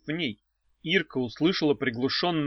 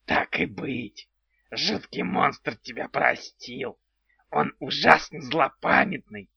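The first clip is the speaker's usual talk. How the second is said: odd creaky little house spirit; goblin voice; slower than usual. Acted. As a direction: play a quirky house goblin, slowly.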